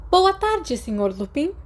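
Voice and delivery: McGonagall's greeting. Acted. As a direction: greet the student crisply from behind the desk.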